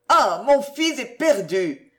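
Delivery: a mother breaking down; crying out in tears. Acted. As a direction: wail that her son is lost. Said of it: "s'écria ... en fondant en larmes".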